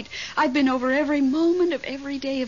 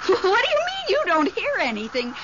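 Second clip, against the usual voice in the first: ordinary talk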